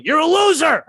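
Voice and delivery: high, loud deadpan put-down; wife in bed mocking her husband, loud and high; wife's jab. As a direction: snap it at him from the pillow. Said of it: "my wife in bed sitting next to me going".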